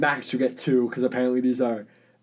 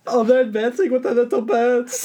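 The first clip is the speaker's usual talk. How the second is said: higher mock-German alarm; German accent; 6 semitones higher than usual. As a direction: play a German soldier sounding the alarm. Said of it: "I'm doing a German accent".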